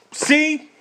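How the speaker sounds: high, loud complaint hurled at God; angry outburst. Acted. Jonah screaming his complaint at God, angry. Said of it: "kinda screams it".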